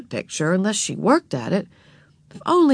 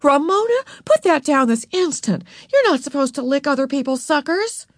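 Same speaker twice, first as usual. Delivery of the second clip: high, raised bossy order; sharp scolding; 7 semitones higher than usual. A narrator's reading. Beezus orders Ramona to drop the sucker, scolding. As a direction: lay down the law to a little sister. Older sister scolds little sister.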